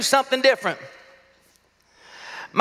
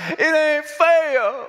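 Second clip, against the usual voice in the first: put-on child whining; 5 semitones higher, much slower than usual. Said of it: "he's like".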